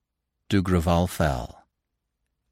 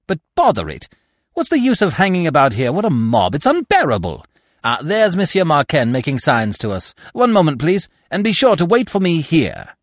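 angry; 6 semitones higher, 4 dB louder than usual. A narrator's reading. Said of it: "continued angrily".

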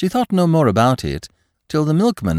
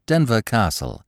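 ordinary delivery, nothing marked

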